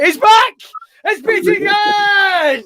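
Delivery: high, loud, slow ring-announcer yell; wrestling announcer introducing the guest, shouting; excited announcer shout. Real, not acted. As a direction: hype the guest's entrance.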